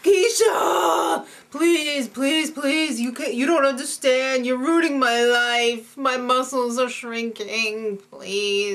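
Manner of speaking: hammed-up desperate begging; overacted whining plea, drawn-out words; [name]. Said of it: "that Jesse's crazy".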